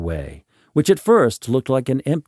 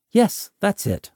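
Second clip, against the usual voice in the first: eager reply; 10 semitones higher than usual. Acted. Tresser answers the strangers.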